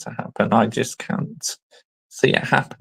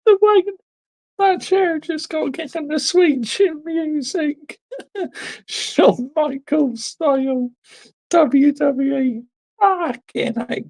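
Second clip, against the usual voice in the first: high, helpless laughing through the words; crying with laughter. Real, not acted. Co-host watching a chair kicked, crying with laughter. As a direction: gasp it out between laughs. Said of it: "I'm nearly in tears watching that".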